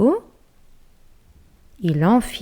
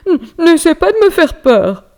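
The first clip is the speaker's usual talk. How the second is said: higher, louder nervous stammer; shaky bear; 11 semitones higher, 9 dB louder than usual. Acted. Scared bear warning the noise, stammering and loud. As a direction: stammer with false bravado. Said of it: "grogna d'une voix mal assurée".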